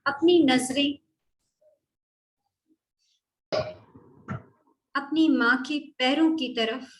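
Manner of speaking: measured, unhurried meditation voice; guided meditation. Acted. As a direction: gently guide listeners through a visualisation, leaving space after each phrase.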